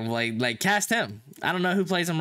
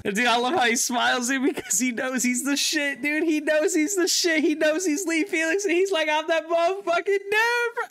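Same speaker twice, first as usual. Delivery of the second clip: high-pitched gushing, climbing as it goes; worked-up fan hype; real excitement; 10 semitones higher than usual. Real, not acted. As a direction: get carried away, pitch rising to the end. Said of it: "I got excited".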